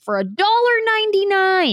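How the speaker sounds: mock hype. Comedian hyping a flip-phone clip download, high and stretched. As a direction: hold it high like a big bargain.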